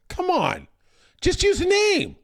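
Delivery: high-pitched mock exasperation; exasperated. Viewer protesting the broadcast's nicknames, exasperated.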